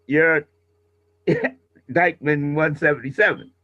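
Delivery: normal talking voice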